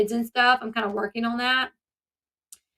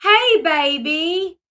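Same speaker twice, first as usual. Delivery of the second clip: loud, drawn-out, higher mock greeting; changed sweet-talk voice; 5 semitones higher, 7 dB louder, much slower than usual. Father greeting his daughter on the phone, mock sweet-talk. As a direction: mimic a father switching to baby talk. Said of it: "his voice changes".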